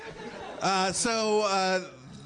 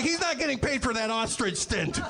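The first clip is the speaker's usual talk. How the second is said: mock indignation; 8 semitones higher than usual. Acts out Max refusing the pay.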